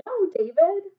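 high, firm scold. Shown as a demonstration. Teacher modelling a scold for the class. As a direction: show the kids how to scold. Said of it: "Tell him".

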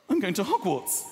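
gasping, high thrilled voice; gasp of excitement. Retold or imitated. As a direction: gasp like a child on Christmas morning. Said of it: "I was so excited".

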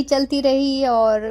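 slow, with रही stretched long; drawn-out repeat. Real, not acted. Vlogger describing the long cave walk, slowly drawn out. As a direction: let रही drag on like the endless walk.

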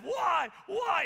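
high, loud repeated demand; hammed-up indignant shout; imitated yelling. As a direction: wail it like an outraged kid. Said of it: "he's yelling".

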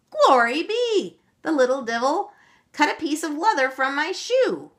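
high, loud cry of delight. A narrator's reading. Auntie Bridget crying out with delight at the cut shoe. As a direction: burst out in delighted wonder. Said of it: "cried Auntie Bridget with delight".

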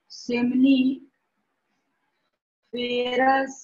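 stretched very slowly, syllable by syllable; drawn-out dictation. Shown as a demonstration. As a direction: draw the word out for the class. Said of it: "seminiferous tubule". (शब्द को खींचकर धीरे बोलना)

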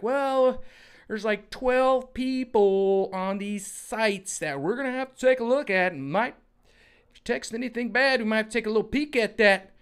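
high, loud sugary official voice; Psaki impression. Mocking the press secretary announcing surveillance, high sugary voice. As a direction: sweet-talk a threat from the podium. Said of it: "she's like".

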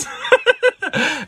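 loud high laugh; burst of laughter. Real, not acted. Laughing loudly at a viewer's teasing question. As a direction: crack up loudly.

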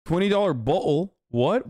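high, disbelieving question; can't believe what he just heard; incredulous. Real, not acted. Incredulous at cheap bottle claim.

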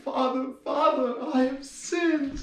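high, slow, wailing nun confessing; pleading nun. Acted. Second nun wailing her sin to the priest, high and slow.

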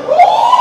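very high, loud "oh"; comic shriek of shock; outraged yell. Retold or imitated. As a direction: cry out as if just slapped. Reaction to being slapped.

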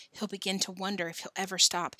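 plain reading voice

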